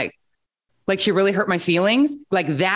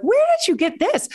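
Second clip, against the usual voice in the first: shocked mom; 12 semitones higher than usual. Retold or imitated. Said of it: "I'm like".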